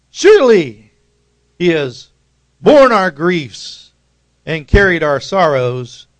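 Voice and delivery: preacher's call before the response; proclaiming read. Shown as a demonstration. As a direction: call it out for the church to answer. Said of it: "I'll read the first half of that".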